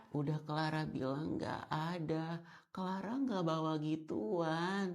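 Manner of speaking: soft, mumbly pick-me voice; soft spoken. Acted. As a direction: murmur like you're too delicate to speak up. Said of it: "Stop diperanin suara lu".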